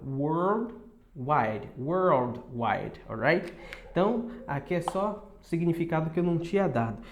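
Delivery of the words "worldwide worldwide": Worldwide with a curled R and dark L; careful English Worldwide; English worldwide demo. Shown as a demonstration. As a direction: drill Worldwide slowly, twice. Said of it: "Worldwide quer dizer".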